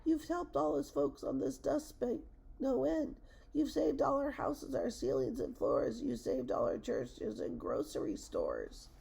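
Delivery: very high, little voice; tiny faint voice. A narrator's reading. Speck-dweller greets the elephant, in a tiny high voice. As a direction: speak as someone minute. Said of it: "came the voice".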